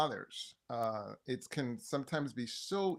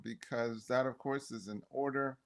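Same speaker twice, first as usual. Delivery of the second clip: ordinary talk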